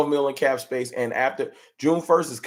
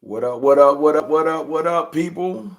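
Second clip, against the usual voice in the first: hype stream opener; 6 semitones higher, 4 dB louder, slower than usual. Real, not acted. Stream opener, hyping up chat.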